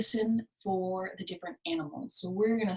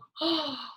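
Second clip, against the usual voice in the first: mock gasp; 5 semitones higher than usual. Shown as a demonstration.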